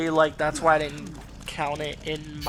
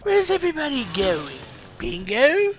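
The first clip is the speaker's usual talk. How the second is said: put-on much higher, comic accented parody, rising on the last word; high-pitched, hammed-up accented taunt; Leon joke voice sliding into Joker; 11 semitones higher, 4 dB louder than usual. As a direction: exaggerate a high, silly accent toward a cartoon villain. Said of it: "almost went to Joker with that bingo part".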